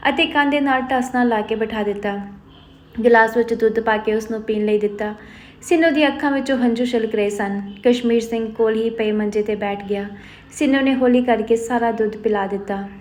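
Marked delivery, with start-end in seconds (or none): none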